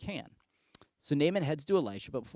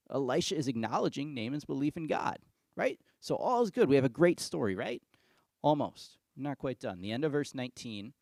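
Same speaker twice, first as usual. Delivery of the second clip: ordinary talk, nothing marked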